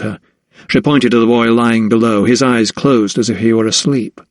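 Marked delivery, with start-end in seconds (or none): none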